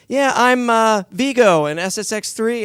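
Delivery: high, loud, slow boast; proud bragging. Retold or imitated. Actor telling all his friends about his game role, mock-proud boast. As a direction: play up a big-shot announcement to friends.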